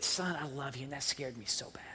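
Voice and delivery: low hushed near-whisper; tender, shaken relief. Retold or imitated. Dad kneels hugging his found son, hushed. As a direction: hush it low, close to the child.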